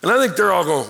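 normal talking voice

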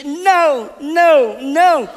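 high, loud repeated no's; distressed toddler. Retold or imitated. Daughter in a bad dream, loud high mock protest. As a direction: cry it out like a half-asleep child. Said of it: "and she".

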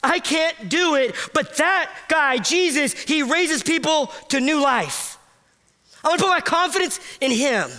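high, wondering voice finding hope; awed realization. Retold or imitated. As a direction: gasp it out in discovery, then trust. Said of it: "and go".

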